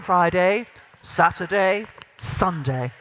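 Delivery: low, weighty days settling deep at the end; gravitas. Shown as a demonstration. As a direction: drop firmly down onto the last day. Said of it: "the gravitas piece".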